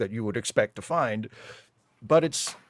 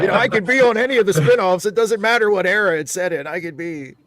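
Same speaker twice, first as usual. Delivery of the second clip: lightly voiced, fast, laughing send-up of an eager actor; a light parody of someone dying to be cast, higher and louder; mock actor voice; 8 semitones higher, 9 dB louder than usual. Teasing a colleague about wanting every show, mock voice with laughter.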